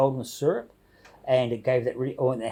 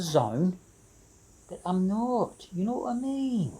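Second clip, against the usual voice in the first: put-on higher, slipping from a Yorkshire accent into Scottish; attempted Yorkshire accent; 6 semitones higher, 3 dB quieter than usual. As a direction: aim for Yorkshire and drift into Scottish. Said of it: "I sort of go to the Scottish thing".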